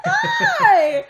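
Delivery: high squealed greeting; excited hi. Retold or imitated. Fangirl rushing up to a stranger, squealing hi. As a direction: burst out with a loud, high hello. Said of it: "I was so excited".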